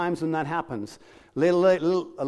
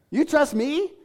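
"You trust me?" pitched much higher, rising in disbelief; incredulous; 9 semitones higher, slower than usual. Retold or imitated. Preacher amazed that Jesus trusts him, rising incredulous question. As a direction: ask "You trust me?" as if you can't believe it.

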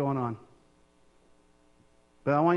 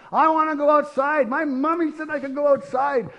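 put-on high, louder little boy throwing a fit; whining toddler; 10 semitones higher, 3 dB louder than usual. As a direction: whine like a stubborn toddler.

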